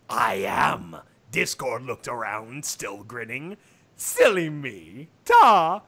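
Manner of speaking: low, smooth trickster drawl; deep Discord voice. Acted. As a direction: play the sly chaos spirit low. Discord speaks.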